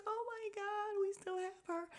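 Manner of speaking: high squeal of happy relief; overjoyed. Real, not acted.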